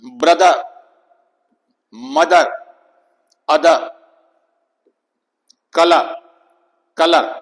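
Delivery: ब्रदर, मदर, अदर, कलर with a loud stressed "uh"; stressed schwa. Shown as a demonstration. Teacher drilling examples, stressed schwa. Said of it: "लेकिन stress होगा इस पर".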